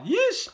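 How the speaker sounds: very high squeaky yish; high squeal. Real, not acted.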